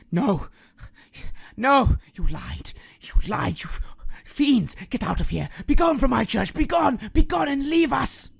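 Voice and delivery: high, loud, frantic banishing cries; furious yelling. Acted. Priest casting fiends out of his church, frantic yelling. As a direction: drive the intruders out with desperate shouts. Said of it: "He starts yelling".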